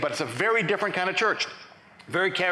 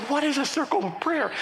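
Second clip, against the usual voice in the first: high, incredulous question; acted bewilderment; 8 semitones higher than usual. Newcomer hearing of prayer circles, acted bewilderment. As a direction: ask it in comic alarm.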